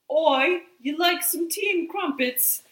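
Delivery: high, hammed-up British accent; bad mock-British greeting; imitated British accent. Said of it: "if British people call".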